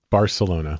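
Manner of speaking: "Barcelona" said plainly, bar-suh-LO-na; "Barcelona" with a clear S. Shown as a demonstration. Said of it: "in that way".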